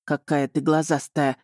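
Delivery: mildly growling duke; irritated, understated growl; a touch of a growl at her. A narrator's reading. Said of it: "рыкнул на меня герцог".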